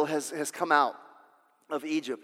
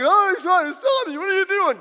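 mocking scold; 8 dB louder than usual. Mocking the persistent listener.

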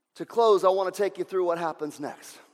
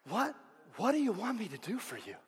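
soft voice of Jesus; 3 semitones higher, 8 dB quieter than usual. Acted. Voicing Jesus softly.

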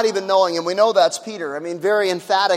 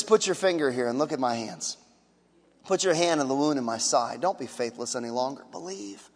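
tender Jesus; 8 semitones lower, 6 dB quieter than usual. Acted. Jesus voiced gently to Thomas.

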